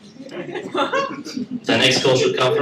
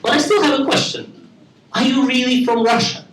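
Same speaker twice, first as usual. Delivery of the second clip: much higher, fast, mock-puzzled fan; imitating an audience member; 10 semitones higher than usual. Audience member questioning the comic after the show, in a much higher mock-puzzled voice. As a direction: squeak it out like a doubtful fan. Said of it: "people come to me and they say".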